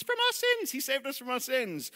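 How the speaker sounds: very high mock-eager pupil voice; squeaky imitation of a Sunday school answer; Sunday school child. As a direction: pipe up like a kid with the stock answer. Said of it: "the Sunday school answer comes".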